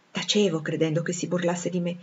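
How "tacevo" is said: plain reading voice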